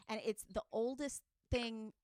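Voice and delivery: usual speaking voice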